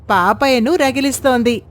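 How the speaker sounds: plain reading voice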